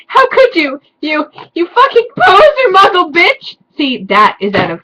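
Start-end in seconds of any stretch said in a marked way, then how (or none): 0.1-3.6 high, loud melodramatic tirade; shouting. Acted. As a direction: shriek it in mock outrage. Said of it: "he shouted".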